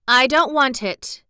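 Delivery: put-on girl refusing breakfast; Mary refusing. A narrator's reading. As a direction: push the plate away. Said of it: "she said".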